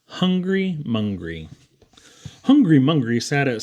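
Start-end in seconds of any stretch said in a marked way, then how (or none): none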